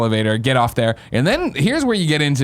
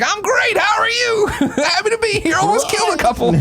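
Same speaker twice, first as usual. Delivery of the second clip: far higher, strained cheery voice with laughter; hammed-up bubbly answer through laughs; mock chipper reply. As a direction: overplay forced enthusiasm. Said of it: "I'm like".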